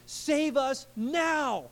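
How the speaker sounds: high, drawn-out cry for rescue; urgent plea. Retold or imitated. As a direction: plead urgently, stretching each word. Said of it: "You guys feel that urgency?".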